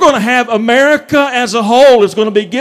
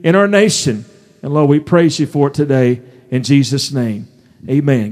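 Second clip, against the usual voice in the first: low, steady prayer voice; earnest prayer; 5 semitones lower than usual. Acted. Pastor praying over his congregation before the sermon, low and earnest.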